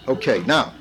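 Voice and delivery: usual speaking voice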